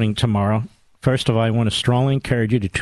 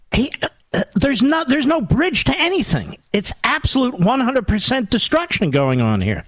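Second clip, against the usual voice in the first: indignant outrage; 9 semitones higher than usual. Real, not acted. Caller describes shutdowns, host erupts.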